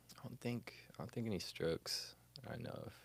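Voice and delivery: unsure, whispered under the breath. Real, not acted. Unsure about strokes, trailing whisper. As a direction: let it drop to a whisper as you think.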